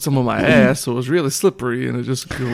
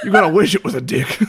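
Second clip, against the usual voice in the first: laughing; 4 semitones higher, faster than usual. Real, not acted. Laughing at own joke.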